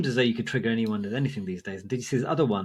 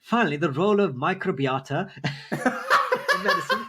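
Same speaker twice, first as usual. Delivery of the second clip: higher, hammed-up pompous reading, cracking up midway; appropriate commenter voice; 5 semitones higher than usual. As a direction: lecture like an affronted commenter, fighting laughter. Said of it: "read it in the appropriate voice".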